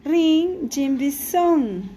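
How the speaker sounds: Rin Jinkison drawn out slowly; slow model repeat. Shown as a demonstration. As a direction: repeat Rin Jinkison at half speed for the class. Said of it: "pongan atención en la pronunciación". (Rin Jinkison muy despacio)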